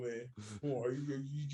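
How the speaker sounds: ordinary talk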